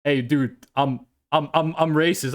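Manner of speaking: low-pitched, halting dude voice. Retold or imitated. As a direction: drop low and stammer out a mock confession. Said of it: "and people go like".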